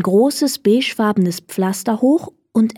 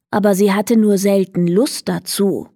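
usual speaking voice

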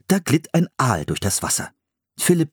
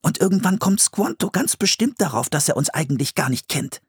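put-on higher-pitched young brother voice; worried Philipp; 11 semitones higher than usual. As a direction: voice the boy Philipp, fretting.

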